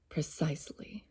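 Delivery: soft unvoiced whisper. Real, not acted. To viewers, a secret whisper. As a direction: breathe it as a secret.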